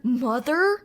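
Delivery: loud, questioning "motherfucker" with a hard R; "motherfucker" as a query, leaning on the R. Shown as a demonstration. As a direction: say "motherfucker" rising, final R fully sounded. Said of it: "saying motherfucker with a hard R".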